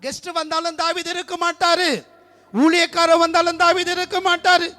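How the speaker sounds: loud, high fervent preaching; full-force preaching. Acted. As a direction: proclaim it to the whole church. (உரத்த குரலில் ஆவேசமான பிரசங்கம்)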